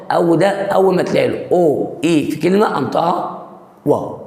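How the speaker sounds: normal talking voice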